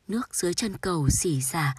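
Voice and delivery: ordinary delivery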